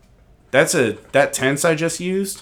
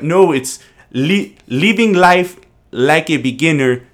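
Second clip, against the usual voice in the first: mock French accent on English lyrics; 4 semitones higher, 4 dB louder, slower than usual. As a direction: imitate a French guy quoting rap lyrics. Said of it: "that's my French person doing English".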